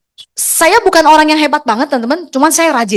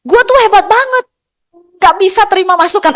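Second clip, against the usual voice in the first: high, louder boastful mock voice; arrogant know-it-all; 8 semitones higher, 3 dB louder than usual. Speaker mocking a know-it-all recruit, high boastful voice. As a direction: mimic the person who thinks he's great. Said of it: "Ada ya pasti ya".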